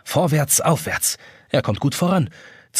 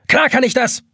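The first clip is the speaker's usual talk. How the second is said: much higher, louder spiteful snap; vengeful hiss; 10 semitones higher, 4 dB louder than usual. A narrator's reading. Pocket watch gloating at Jonas, spiteful and vengeful. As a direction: spit it out with revenge. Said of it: "zischt die Taschenuhr rachsüchtig".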